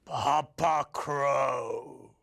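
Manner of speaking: low, drawn-out, weighty announcement; big strong crow. A narrator's reading. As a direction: introduce the mighty father crow, low and very slow. Introduces big strong father crow.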